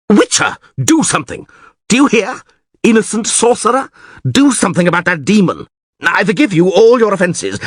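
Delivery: high, urgent, exclamatory tirade; panicked ranting. A narrator's reading. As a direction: blame and plead as your town falls. Mayor rants in panic.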